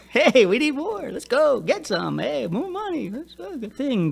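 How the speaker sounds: high, slow sing-song wheedling; hammed-up kid pestering for money; begging kids' voice. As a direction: swoop up and down, dragging it out. Voicing money-hungry kids at parents.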